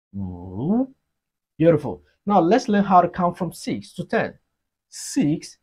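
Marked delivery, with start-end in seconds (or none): 0.1-0.9 "wu" dipping very low, then swooping up; falling-rising tone. Shown as a demonstration. Teacher models Chinese five for learners, dipping-rising "wu". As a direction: draw out "wu" from a low dip to a rise. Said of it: "It has the falling rising tone".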